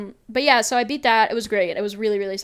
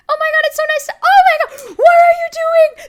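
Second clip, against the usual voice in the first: very high, loud panicked cartoon voice; escalating comedy rant; 12 dB louder than usual. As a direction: squeal it in rising horror. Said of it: "his voice just goes from".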